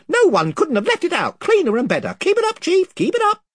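a squeaky crowd of toadies egging him on; chorus of fawning followers. A narrator's reading. Other voices cheer chief.

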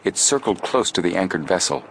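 ordinary delivery, nothing marked

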